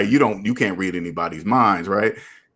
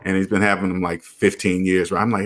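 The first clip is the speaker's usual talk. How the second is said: ordinary delivery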